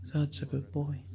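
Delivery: usual speaking voice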